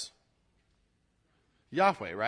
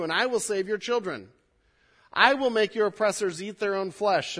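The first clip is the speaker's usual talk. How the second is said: strong, louder proclaiming reading; scripture reading; 3 semitones higher, 4 dB louder than usual. Acted. Pastor reads God's promise to rescue, proclaiming. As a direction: proclaim the verses boldly.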